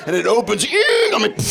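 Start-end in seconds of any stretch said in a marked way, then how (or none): 0.7-1.1 high squeal. Retold or imitated. Door opens against belly.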